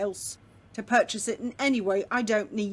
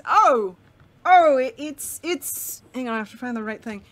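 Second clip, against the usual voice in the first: excited greeting; 3 semitones higher, 5 dB louder than usual. Acted. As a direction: gush like a delighted old friend. Millen greets them excitedly.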